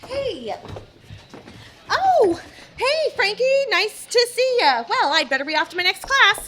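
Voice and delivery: high, nervous fake cheer; nervous high greeting. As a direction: hurry off sounding jittery. Nervous kid greets bully.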